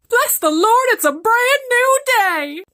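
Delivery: very high, loud, slow exultant cry; a freed reindeer praising the heavens; hammed-up jubilant shout; joyous reindeer.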